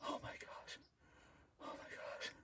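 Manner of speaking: barely audible disbelief; hushed shock; awed whisper. Real, not acted.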